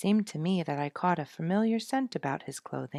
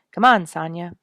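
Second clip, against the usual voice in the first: resigned; 3 semitones higher, 15 dB louder than usual. A narrator's reading. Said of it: "said Dick resignedly".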